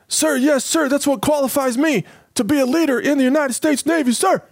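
high, eager mock recruit voice; mock eager sailor. Host plays a clueless recruit bragging to his officer in a high mock voice. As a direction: answer proudly like a clueless recruit.

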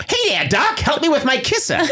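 put-on high, hammy old-timey Brooklyn tough guy; 1930s Brooklyn guy. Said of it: "why am I talking like a nineteen thirties guy from Brooklyn?".

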